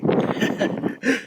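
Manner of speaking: light unvoiced chuckle after a brag; a quick airy laugh. Real, not acted. Laugh after a boastful "come get it.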